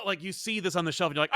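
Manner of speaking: normal talking voice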